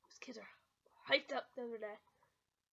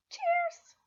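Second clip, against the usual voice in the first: cheery toast; 14 semitones higher, 5 dB louder than usual. Retold or imitated. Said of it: "taught them how to go".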